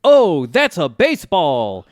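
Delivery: big announcer call, slow and loud; video game announcer. Retold or imitated. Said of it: "hear the video game announcer go".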